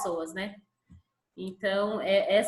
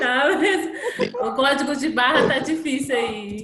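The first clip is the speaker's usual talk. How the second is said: laughing; 4 semitones higher, 7 dB louder than usual. Real, not acted. Laughing at glitched audio.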